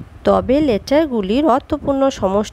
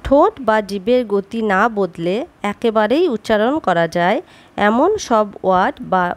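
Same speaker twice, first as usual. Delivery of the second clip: ordinary talk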